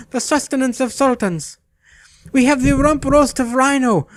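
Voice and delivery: high, sing-song sales patter; a servile market seller, delighted; fawning meat merchant. Acted. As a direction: rattle off the meats with glee. Meat merchant's alliterative sales pitch.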